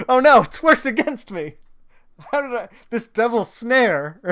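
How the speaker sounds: loud, high-pitched playful panic; mock alarm. Jokingly cries out in mock alarm.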